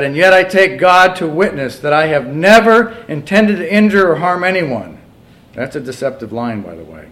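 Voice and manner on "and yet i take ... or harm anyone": loud, high declamation of a confession; impassioned reading. Acted. Preacher reads the man's self-defence to congregation, loud and raised. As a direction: plead the written words out forcefully.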